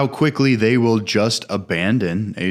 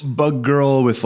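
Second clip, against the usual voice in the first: usual speaking voice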